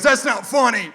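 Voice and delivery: high-pitched mock protest; indignant brother. Brother scolds his joking sibling over his son, mock indignant. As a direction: imitate an offended brother, pitched well up.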